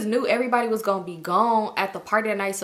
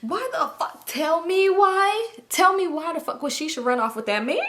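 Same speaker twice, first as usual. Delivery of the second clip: stressed exasperation; 9 semitones higher than usual. Real, not acted. Storyteller asking why Shisha ran off, stressed exasperation. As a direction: plead for an answer, pitched up and stretched. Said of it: "I was stressed out".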